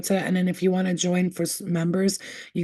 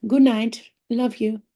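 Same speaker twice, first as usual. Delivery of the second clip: Good night called higher and louder, sing-song; bright call; 4 semitones higher, 4 dB louder, slower than usual. Real, not acted. Saying bye to the viewers, higher louder sing-song good night.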